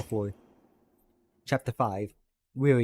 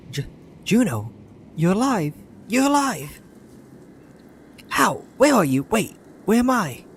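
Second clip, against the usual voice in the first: much higher and louder, astonished; shocked Avond; 10 semitones higher, 7 dB louder than usual. Acted. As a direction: gasp it out as a friend thought lost.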